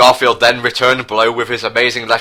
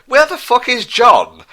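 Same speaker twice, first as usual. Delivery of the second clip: much higher, indignant shriek; sudden outburst; 4 dB louder than usual. Acted. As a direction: blurt it out like a scandalised fan. Reader breaks into sudden outburst.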